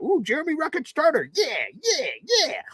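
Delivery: hammed-up co-host cheering a starter; mocking co-host. Teasing co-host about cheering for Jeremy Ruckert, hammed-up high excited voice. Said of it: "you were doing pelvic thrust about".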